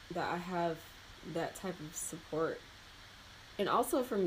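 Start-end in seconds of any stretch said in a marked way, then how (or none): none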